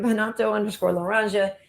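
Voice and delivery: ordinary delivery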